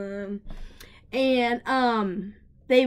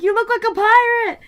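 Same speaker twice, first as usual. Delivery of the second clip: loud, high manic delight; excited exclamation; 13 semitones higher, 11 dB louder than usual. Retold or imitated. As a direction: shout it like a thrilling discovery. Acts out own excited remark.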